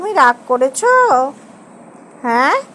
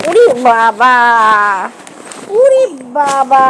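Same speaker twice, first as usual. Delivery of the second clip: exclaiming; 5 semitones lower, 4 dB louder, slower than usual. Real, not acted. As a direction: stretch out the exclamation. Exclaiming at the child. (টেনে টেনে অবাক হওয়া)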